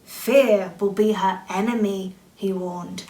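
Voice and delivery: plain reading voice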